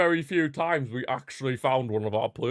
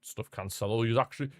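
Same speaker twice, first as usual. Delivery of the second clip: usual speaking voice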